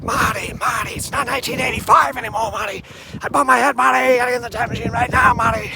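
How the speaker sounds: urgent mad-scientist rush; frantic movie scientist. Retold or imitated. The scientist urging Marty into the time machine, frantic. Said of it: "the more impressions you can do".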